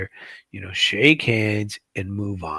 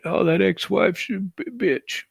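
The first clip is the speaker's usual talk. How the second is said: mocking voice; 9 semitones higher than usual. Mocking someone insulting his ex-wife.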